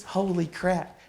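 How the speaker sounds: put-on high, amazed old-timer's cry; amazed exclamation. Old mentor amazed at the student's rental plan, high excited voice. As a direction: exclaim it like he can't believe it.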